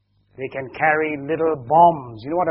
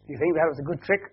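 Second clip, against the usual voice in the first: ordinary delivery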